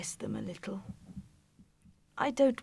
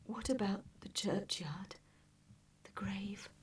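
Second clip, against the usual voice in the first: high, hushed whispery woman; frail, hushed mother's voice; quiet asking; 8 dB quieter than usual. A narrator's reading. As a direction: ask softly about the grave, almost whispering. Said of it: "asks Missus Cratchit quietly".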